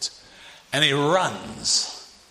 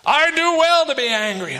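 stubborn Jonah; 11 semitones higher, 4 dB louder than usual. Retold or imitated.

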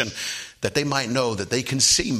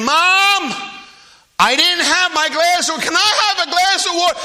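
put-on very high, loud child's holler; insistent little boy, acted out; yelling downstairs; 8 dB louder than usual. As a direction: shout for Mom at full pitch. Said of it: "He yelled down again".

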